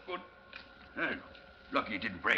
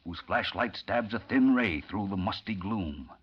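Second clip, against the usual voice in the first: measured, low narrator read; dark tunnel scene-setting narration; radio drama narration; 5 semitones lower, 4 dB quieter than usual. A narrator's reading. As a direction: set the scene like an old radio announcer.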